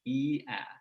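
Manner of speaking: "ee" then "ah", held vowels; drawn-out sample vowels, jaw dropping; vowel demo. Shown as a demonstration. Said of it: "as you go from ee to ah".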